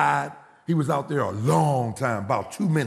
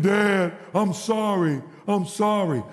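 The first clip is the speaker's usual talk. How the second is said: imitated crying son, pitched up; higher, pleading retold apology; tearful apology; 5 semitones higher, slower than usual. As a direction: beg dad's pardon in mock tears. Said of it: "he's crying".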